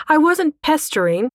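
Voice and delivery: high-pitched, affronted denial; indignant squawk. A narrator's reading. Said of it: "she squawked with wide eyes".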